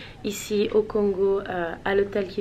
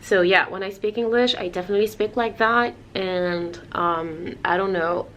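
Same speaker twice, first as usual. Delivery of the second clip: her English-speaking tone. Shown as a demonstration. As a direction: slip into the tone she uses for English. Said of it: "je vais avoir ce ton-là".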